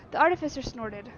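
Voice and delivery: ordinary talk, nothing marked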